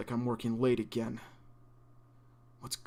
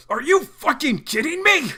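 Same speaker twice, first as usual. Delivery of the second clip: much higher and louder, slowed angry yell; furious outburst; 11 dB louder, slower than usual. Acted. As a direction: explode at the nurse for bringing more. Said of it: "And he's pissed".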